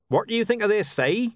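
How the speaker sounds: jolly neighbour booming in; a bright hearty greeting; cheerful. Acted. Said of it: "said a cheerful voice".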